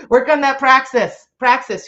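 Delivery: loud, high push; rally everyone to act; loud closing rallying call to viewers. Real, not acted.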